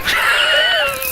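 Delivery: a sudden, very high squeal of laughter; high shrieking laugh. Real, not acted. Shrieking at a donor's name.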